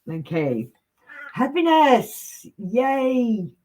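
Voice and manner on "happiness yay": much higher, slow cheerful Happiness then a high Yay; mock-cheering the card's happy message; excited cheer. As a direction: celebrate the card like a kid at a party.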